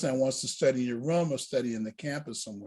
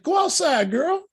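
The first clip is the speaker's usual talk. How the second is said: much higher, bright urging call; urging exclamation; 12 semitones higher than usual. Retold or imitated. Deacon telling the whispering student to step outside, high urging call. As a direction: pitch it way up, telling her to go.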